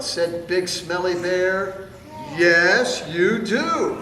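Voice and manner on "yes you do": loud, gruff insistence; mock growl. Fluffy bear insisting to smelly bear, loud mock growl. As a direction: growl it back like a stubborn bear. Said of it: "growled Big Fluffy Bear".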